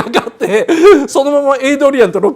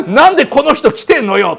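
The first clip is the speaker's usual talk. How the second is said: angry; 4 semitones higher, 5 dB louder than usual. Retold or imitated. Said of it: "エイドリアンブチ切れで".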